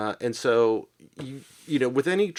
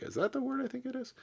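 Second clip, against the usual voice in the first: high, puzzled inner voice, pitched well up; mock double take; 8 dB quieter, faster than usual. Reader baffled by a strange translated word, surprised high voice. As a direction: play a reader startled by an odd word. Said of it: "you kind of do a double take".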